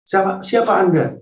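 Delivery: "siapa Anda" pitched high with firm emphasis; high, pressing "siapa Anda", falling at the end; insistent question. Retold or imitated. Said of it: "sambil saya menekan intonasi pertanyaan saya".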